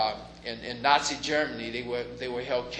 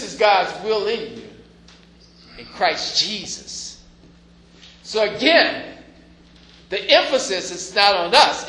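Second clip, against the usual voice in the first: preaching at full force; 5 semitones higher, 7 dB louder than usual. Acted. Preaching climax.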